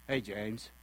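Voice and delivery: low, quiet, flat "Hey, James"; cold greeting. Shown as a demonstration. Friend answering the phone, unhappy to hear from James. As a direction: greet him with no warmth at all. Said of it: "silence and".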